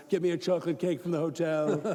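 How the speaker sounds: loud put-on voice of a pampered leader; hammed-up order for comfort food; mock presidential demand. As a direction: play a self-pitying bigwig, loudly. Mocking a sulking president in back.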